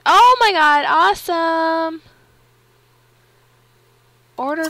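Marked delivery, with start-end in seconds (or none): 0.0-2.0 loud, high, drawn-out excitement; thrilled. Real, not acted. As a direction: exclaim it loudly, stretching it out. Thrilled by game feature.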